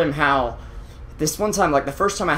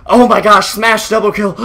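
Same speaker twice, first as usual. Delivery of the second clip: loud, raised caster's hype cry; acted amazement; 5 semitones higher, 7 dB louder than usual. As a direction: hype up a big play, playing it up. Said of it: "Smash's play is just making me".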